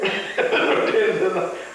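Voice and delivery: loud laughter; real laugh between quotes. Real, not acted.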